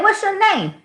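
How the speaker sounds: high exasperated question; teacher asking. Retold or imitated. Teacher asks the boy his name, high and exasperated. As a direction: ask the kid in disbelief. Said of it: "And I'm like".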